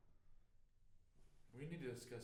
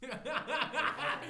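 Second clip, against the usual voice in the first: loud laughing insistence; 14 dB louder, much slower than usual. Real, not acted. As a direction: laugh through the correction. Laughing defence of headphones.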